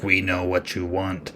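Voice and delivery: low, soft, eerie demon voice; hushed, otherworldly offer from below; dream demons. Retold or imitated.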